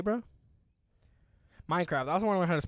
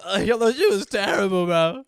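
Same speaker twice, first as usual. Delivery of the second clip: loud mock complaint; 7 semitones higher, 11 dB louder than usual. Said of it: "Y'all were".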